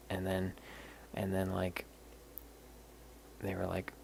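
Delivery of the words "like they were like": normal talking voice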